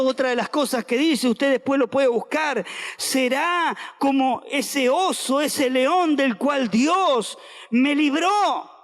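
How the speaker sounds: ordinary talk, nothing marked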